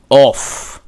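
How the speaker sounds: off with a throaty ayin onset; guttural sound. Shown as a demonstration. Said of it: "Uh, uh, uh".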